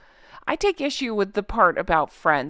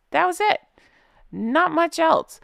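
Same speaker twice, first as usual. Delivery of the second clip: incredulous scoff; 8 semitones higher than usual. Real, not acted. Mocking the tiny tally.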